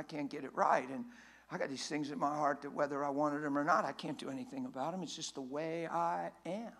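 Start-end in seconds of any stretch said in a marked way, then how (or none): 3.6-6.7 mock excuse. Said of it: "You ever hear anybody label themselves like that?".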